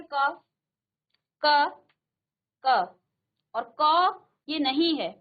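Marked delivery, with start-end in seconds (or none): 0.0-3.0 क said alone, loud and clear, three times; teacher sounding out क for the class; sounding out the letter. Shown as a demonstration. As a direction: demonstrate क crisply, syllable by syllable. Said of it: "जब आप इसका उच्चारण करेंगे तो आप क्या कहेंगे".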